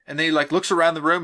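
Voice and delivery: ordinary talk, nothing marked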